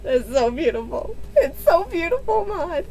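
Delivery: high, breaking voice; tearful joy. Real, not acted. Thanking the friend for a beautiful book, tearfully. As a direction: near tears from being touched. Moved by gift, near tears.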